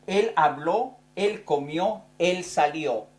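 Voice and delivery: habló, comió, salió with the last O clearly stressed; a teacher marking the accent on the O of habló, comió, salió; stressed final O. Shown as a demonstration. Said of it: "en la tercera persona lleva acento la O".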